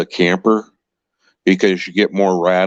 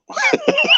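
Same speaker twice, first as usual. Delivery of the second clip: light laugh. Real, not acted. Laughs at own joke.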